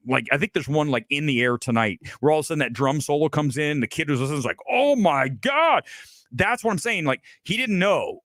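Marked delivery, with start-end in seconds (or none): 4.7-5.8 high, drawn-out astonishment; amazed. Retold or imitated. Kid hearing the drum solo drop, amazed. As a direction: stretch it out high in mock awe.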